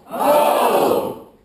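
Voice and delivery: loud answering yell; echoed shout. Shown as a demonstration. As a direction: shout it back to pass it on. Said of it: "We're gonna try that now".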